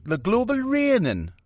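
high Geordie-style rock star voice. Retold or imitated. Rock star voice mocking global raining talk. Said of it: "The old Geordie".

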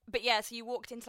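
ordinary talk

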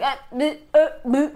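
high, broken stammer of nonsense sounds; tongue-tied sputtering. Retold or imitated. Friend acting out J Lo unable to speak, mock tongue-tied sputter. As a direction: imitate someone failing to get words out. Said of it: "like, trying to talk".

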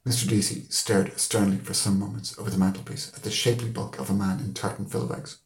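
usual speaking voice

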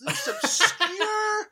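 slow, high, laughing; mock confused voice. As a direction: act baffled.

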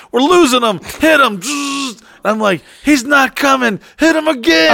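high, loud frantic doctor calls; ER doctor panic. Retold or imitated. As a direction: yell orders like a surgeon losing a patient. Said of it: "I was in the hospital".

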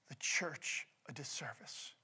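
hushed, the last words breathed out; a sudden whispered close. Real, not acted. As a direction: drop to a whisper to land the point. Sermon point dropped to a whisper.

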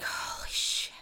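whispered mock amazement; hushed, reverent disbelief, acted; awed whisper. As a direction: breathe it out, stunned by the taste. Retold awe at tasting food.

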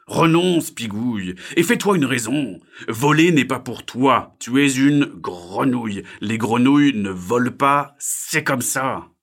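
loud, slow, emphatic scolding; stern Diego. Acted. Diego tells frog to give up, loud stern voice. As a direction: lay it down firmly and loudly.

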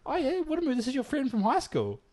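very high old-lady imitation. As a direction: introduce them as a cheery, high-voiced old lady. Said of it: "the old lady comes out and goes".

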